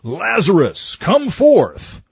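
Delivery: loud, high-pitched shouted summons; big ringing shout, pitched well up; commanding shout. Acted. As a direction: call it out forcefully, as if summoning someone from a tomb. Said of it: "and shout".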